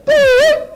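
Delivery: high bright ding; imitated ding. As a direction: ring it out high. Imitated bell.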